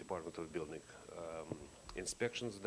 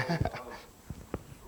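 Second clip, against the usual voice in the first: laugh; 5 semitones higher, 5 dB louder than usual. Real, not acted. Laughs at own mouthful.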